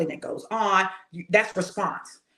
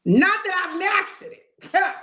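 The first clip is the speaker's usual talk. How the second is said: emphatic admission; 9 semitones higher, 4 dB louder, slower than usual. Real, not acted. Preacher's humble aside.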